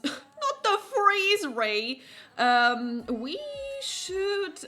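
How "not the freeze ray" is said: high, slow, laughing no; laughing protest. Real, not acted. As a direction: giggle it out, high and drawn out. Laughing protest at game choice.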